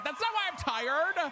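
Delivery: high, drawn-out indignant whine; indignant protest. Real, not acted. Protests a viewer's scolding.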